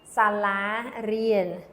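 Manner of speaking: Sala rien, each syllable drawn out slowly; slow model word. Shown as a demonstration. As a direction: say Sala rien slowly so students can follow. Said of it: "Say it with me".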